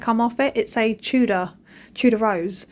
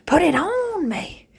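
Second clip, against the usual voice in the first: dog voice; 10 semitones higher, much slower than usual. Retold or imitated. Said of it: "He lays there like".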